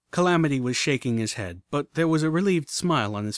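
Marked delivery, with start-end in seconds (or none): none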